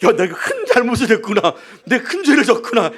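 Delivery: laughing through a rueful confession; laughing realization. Retold or imitated. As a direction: chuckle as you admit the mistake. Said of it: "그때 알았어요". (웃으며 잘못을 깨닫는 말투)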